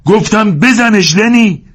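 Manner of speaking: high, loud, slow command; forceful drawn-out shout. Acted. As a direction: yell it insistently at Lennie. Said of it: "جورج فریاد زد".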